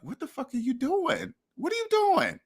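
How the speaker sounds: a lawyer who can't believe what he's hearing; incredulous lawyer. Retold or imitated. Lawyer asks client why fight it, incredulous and climbing high. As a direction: pitch jumps high in disbelief at the client. Lawyer reacting to a hopeless case.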